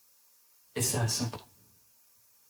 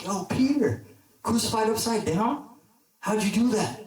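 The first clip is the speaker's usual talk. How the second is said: much higher, hyped-up hailing; excited, amazed; 7 semitones higher than usual. Acted. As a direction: call out to a hero in amazement. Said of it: "He's so excited".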